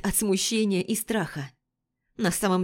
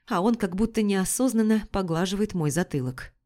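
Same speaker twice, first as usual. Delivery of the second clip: plain reading voice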